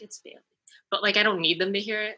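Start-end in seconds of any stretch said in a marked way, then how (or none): 0.0-0.4 very soft muttered correction; under the breath. Retold or imitated. Guest privately correcting friends who get the surname wrong, under the breath. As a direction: slip it out barely audibly, just for yourself. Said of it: "under my breath, I'll be like".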